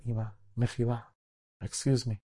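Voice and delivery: acted gentle interjection; gentle daughter. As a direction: soothe an upset mother quietly. Daughter gently calms upset mother.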